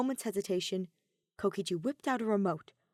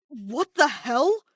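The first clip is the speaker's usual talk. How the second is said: loud, slow shocked sputter; 7 semitones higher, 9 dB louder, much slower than usual. Acted. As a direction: spit it out in disbelief. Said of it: "he heard Kaito sputter".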